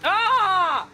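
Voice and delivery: sudden high yelp of fright; a sharp real gasp-shout as a car dives inside; short high shriek of surprise; startled yelp. Real, not acted. Startled by a risky overtake.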